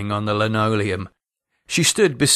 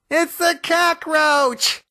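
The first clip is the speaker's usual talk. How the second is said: higher, much slower panicky echo; wife climbing onto a chair, panicky echo; wife's panicky echo; much slower than usual. A narrator's reading. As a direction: shriek it back in fright. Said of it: "Maureen confirmed".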